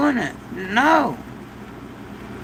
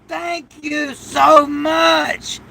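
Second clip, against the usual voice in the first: heartfelt thanks; 6 semitones higher, much slower than usual. Real, not acted. Slow grateful thanks.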